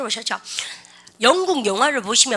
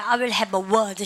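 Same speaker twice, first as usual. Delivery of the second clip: slow drill; 3 semitones lower, much slower than usual. Shown as a demonstration. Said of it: "해보세요".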